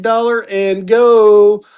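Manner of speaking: long drawn-out GO; sing-out sales call, slow and stretched; auctioneer's opening call. Real, not acted. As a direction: holler it out slowly, stretching the last word. Auctioneer calling the opening bid.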